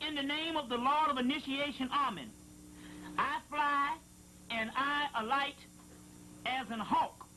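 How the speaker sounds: scripture reading. Acted. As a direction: intone it like holy text. Reading a sacred passage aloud.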